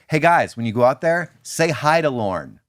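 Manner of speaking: lightly voiced producer briefing; producer instructing. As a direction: drive the lesson home to the cast. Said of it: "the producer ... goes".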